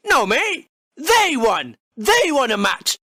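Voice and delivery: ordinary talk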